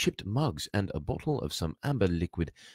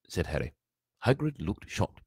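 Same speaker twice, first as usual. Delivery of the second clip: usual speaking voice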